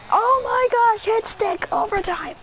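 high-pitched shout of triumph, easing off; real gamer hype; excited outcry. Real, not acted. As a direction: cry out high at the big hit. Celebrating a big hit.

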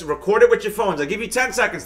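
ordinary delivery

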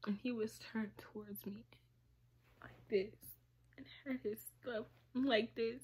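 very soft, voiceless whisper; real whisper while describing the couch scene. Real, not acted. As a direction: drop to a hush as if it hurts to say. Hushed retelling of the abuse.

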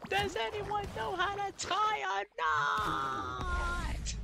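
very high, loud, drawn-out plea; cartoon wail. Gamer quoting a favorite episode, high desperate cartoon wail. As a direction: wail it slowly in a loud falsetto.

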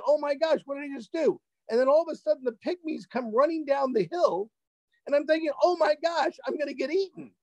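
high-pitched storyteller's alarm; retold panic. Traveler fearing he will get eaten, mock panic. Retelling fear of being eaten.

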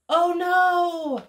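big gasp, then high, loud, drawn-out dismay; storybook shock with a sharp intake of breath; gasping alarm. Acted. Reader as the whole alphabet overloads the tree, gasping alarm.

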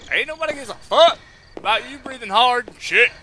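mock scolding of his own panting; sassy retort. Real, not acted. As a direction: tell the breathing off with attitude. Snaps at his own breathing.

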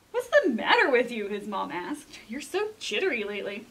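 lively picture-book reading with dramatic swings; storytime narration. A narrator's reading. Librarian reading a picture book to kids, storytime voice. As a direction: read it to a group of children, playing up the scary parts.

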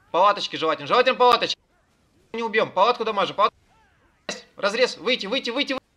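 loud, high shouted calls; shouted orders. Real, not acted. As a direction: yell orders over the fight. Raid leader shouting orders.

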